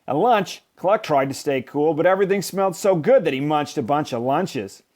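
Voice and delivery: plain reading voice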